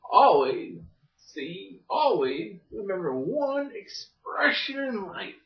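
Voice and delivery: slow, sappy delivery with a hammed-up crying sound; mock tearful. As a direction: pretend to choke up over your own wisdom. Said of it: "that was sappy".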